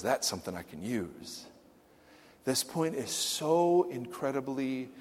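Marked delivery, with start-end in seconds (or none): 0.0-1.4 very soft, low tender voice; soft reverent acceptance; hushed tender. A narrator's reading. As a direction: let it land gently and quietly. God's tender reply again.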